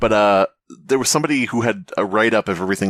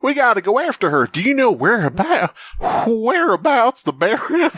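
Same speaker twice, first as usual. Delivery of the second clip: very high, hammed-up character voice; exaggerated high-pitched mimicry, breaking into laughter; Ellis; 13 semitones higher than usual. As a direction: plead urgently in a high put-on voice. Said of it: "this is Ellis from Left four Dead two".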